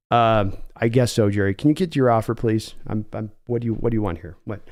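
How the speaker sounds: lowkey John Schneider on the phone; low, weary team executive; calm Seattle GM. Acted. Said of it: "give John Schneider".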